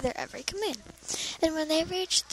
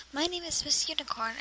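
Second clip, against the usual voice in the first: ordinary delivery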